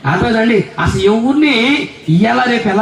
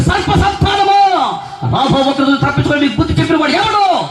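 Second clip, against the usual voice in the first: high shouted denunciation; thundering imitation of a prophet, pitched high; harsh prophet's rebuke; 6 semitones higher than usual. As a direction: stretch out the opening cry, then demand an answer. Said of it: "మాట చూస్తే కఠోరంగా ఉంది".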